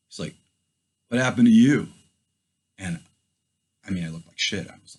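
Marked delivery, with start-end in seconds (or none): 1.1-1.9 a louder, pushing officer's demand; trooper's loud question. Retold or imitated. Trooper questioning the dusty rider, loud demand. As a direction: call it out like a cop sizing him up.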